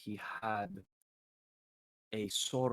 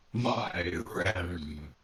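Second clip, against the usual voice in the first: low, slow booming otherworldly voice; roaring demon voice; 3 semitones lower, 5 dB louder, much slower than usual. Acted. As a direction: roll it out like a demon from a fiery portal. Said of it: "this loud, roaring voice".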